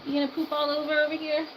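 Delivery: high, loud sing-song cooing at a chick; pet talk. Real, not acted. Owner teasing her chick about its poop, high cooing. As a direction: tease the chick sweetly in a high voice.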